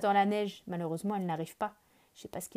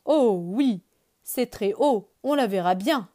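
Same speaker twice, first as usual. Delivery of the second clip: excited agreement; 2 semitones higher, 8 dB louder than usual. Acted. Character answers excitedly.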